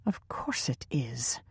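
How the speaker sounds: disgusted slow whisper. A narrator's reading. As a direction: spit it out quietly in disgust. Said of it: "Teresa said with disgust".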